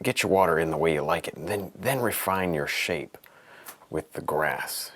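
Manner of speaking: ordinary talk, nothing marked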